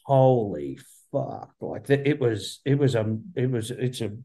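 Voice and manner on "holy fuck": slow awed gasp. Retold or imitated. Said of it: "I turned around and just went".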